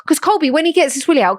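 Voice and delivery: ordinary delivery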